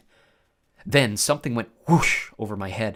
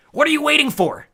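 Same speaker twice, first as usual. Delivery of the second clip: high, loud demanding shout; impatient warrior girl; 9 dB louder than usual. A narrator's reading. Clarice pushing Percy to strike, impatient. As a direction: hurry him up, fiercely.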